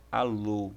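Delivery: "alô" ending on a closed, circumflex o; closed final O. Shown as a demonstration. Teacher giving an example of omega, "alô" with closed o. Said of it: "mais pra um circunflexo".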